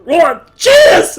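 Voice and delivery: very high, loud toast; toasting dwarf. Acted. Father toasting the party, loud. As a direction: raise a tankard and shout it.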